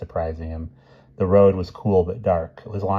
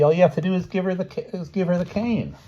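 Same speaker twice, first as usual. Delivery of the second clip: high-pitched eager questioning; puzzled boy Ricky; 12 semitones higher than usual. A narrator's reading. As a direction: pipe up high like a puzzled kid. Narrator voices the boy Ricky.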